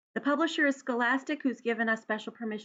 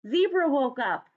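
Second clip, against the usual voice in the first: usual speaking voice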